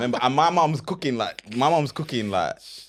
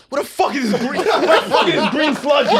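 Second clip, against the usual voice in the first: laughing; 11 semitones higher, 5 dB louder than usual. Retold or imitated. Flatmate voiced in disgust.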